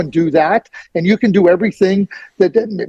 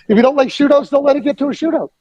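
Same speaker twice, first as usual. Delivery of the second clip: higher, fast, laughing comeback; laughing retort; 8 semitones higher, faster than usual. Retold or imitated. Host laughing as he repeats shootout line. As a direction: repeat it again, amused. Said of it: "what I would say".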